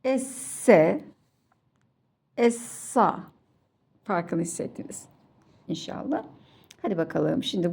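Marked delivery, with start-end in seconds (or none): 0.0-1.0 ESSE with a light hissing s and no lisp; thin s. Shown as a demonstration. Teacher doing all three together for children, thin s. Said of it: "İnce". 2.4-3.2 ESSA with a heavy s and dark "a"; thick s. Shown as a demonstration. Teacher doing all three together for children, thick s. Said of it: "bu kalın".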